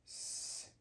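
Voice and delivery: "s" as a bare hiss; soft, voiceless "s"; s sound. Shown as a demonstration. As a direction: sustain a quiet "s" with no vowel. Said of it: "sounds like".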